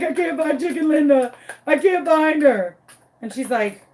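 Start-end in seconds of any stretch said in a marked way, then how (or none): 0.0-2.8 high, whiny wail; mock crying. Telling Kat the chicken is lost, mock crying. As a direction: wail it like a hammed-up sobber. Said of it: "I am crying my eyes out, crying".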